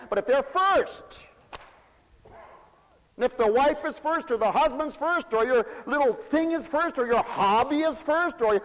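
high, loud, ringing revival preaching; full-force preaching. Acted. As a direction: preach it out to the whole hall. Preacher voicing God's call.

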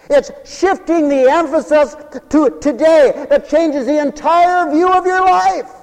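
loud, high-pitched sermon delivery; full-force preaching. Acted. Preacher mocking resolutions made for tomorrow, full-force preaching.

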